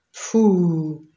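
a gruff outburst, only partly shouted; mild narrated roar. A narrator's reading. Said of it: "Ordított fel a lóbőr". (enyhe, érdes felordítás)

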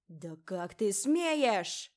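slow, furious screamed outrage; hysterical shriek. A narrator's reading. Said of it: "Истеричный визг леди Морвин".

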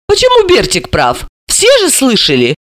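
high-pitched puzzled objection; surprised. A narrator's reading. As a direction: question it in surprise. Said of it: "удивилась Далила".

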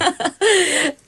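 light social laugh; a short, gracious laugh for the guest; polite laugh. Acted. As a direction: hostess laughing at the doctor's greeting. Mother greets doctor socially.

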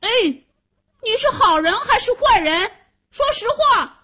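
put-on high, loud child's challenge; calling out. Boy questions a stranger in the water, high shouted demand.

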